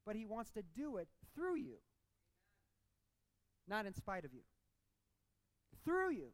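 emphatic pulpit delivery, pausing hard between declarations; impassioned preaching. Real, not acted. Preacher urging the church to change the culture, impassioned. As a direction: preach it with rising, punched emphasis.

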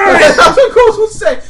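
high, loud, slow and laughing; laughing orc shout. Acted. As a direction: repeat it cracking up. GM repeats through laughter.

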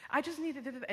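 high-pitched, mildly tearful plea; retold tearful plea. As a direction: imitate pleading through tears, pitched high. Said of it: "I'm shaking and I'm crying".

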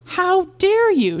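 high, loud, drawn-out outrage; scandalised, stretching the words high and loud; outraged cry. A narrator's reading. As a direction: cry out slowly in indignation. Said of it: "she cried".

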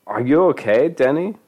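high, rising urgent question. A narrator's reading. Johnny checks on Denny, urgent rising question. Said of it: "Johnny asked urgently".

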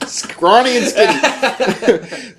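slow, laughing self-mockery. Real, not acted. Laughing about being skinny in high school. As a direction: giggle through it at yourself.

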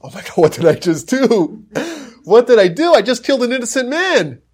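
high, loud panicked cry; horrified realization. Acted. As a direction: gasp it out in horror. Sage realizes he killed innocent man.

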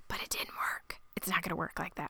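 hushed ominous whisper. Real, not acted. Podcast host whispering that the plan will fail. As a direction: drop to a whisper as if sharing a dark secret.

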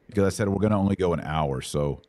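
ordinary talk, nothing marked

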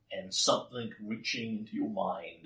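ordinary talk

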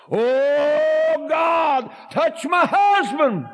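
building from pleading to a high crying-out plea; mother praying aloud, from pleading to shouting; desperate prayer. Retold or imitated. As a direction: cry out to God, rising to a shout.

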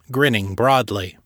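usual speaking voice

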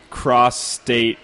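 ordinary delivery

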